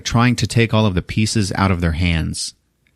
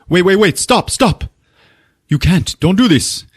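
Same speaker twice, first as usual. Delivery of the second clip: high, urgent begging; acted desperate Javier; retold panicked plea; 9 semitones higher than usual. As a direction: plead high and frantic to stop her. Retold Javier pleading with her.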